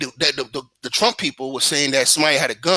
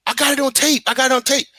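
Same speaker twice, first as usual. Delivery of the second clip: put-on pleading girl; 9 semitones higher than usual.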